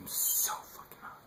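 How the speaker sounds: breathy whisper, slow; slow whispered aside. Real, not acted. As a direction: whisper it under your breath like a confession. Aside after clearing throat.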